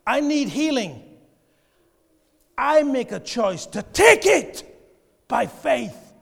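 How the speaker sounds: loud, shouted declaration of faith; bold faith declaration. Shown as a demonstration. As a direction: claim it like you mean it, full voice.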